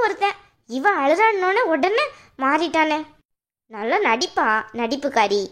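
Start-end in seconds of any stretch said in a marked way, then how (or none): 3.7-5.4 low, scornful taunt; sister-in-law jeers at her crying as fake; mocking sneer. Taunts a crying woman as actress.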